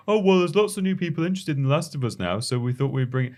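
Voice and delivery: high, sing-song corporate excuse; mocking publisher voice. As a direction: parody a smug publisher. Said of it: "what I'm saying is that even that justification".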